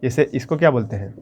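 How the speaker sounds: normal talking voice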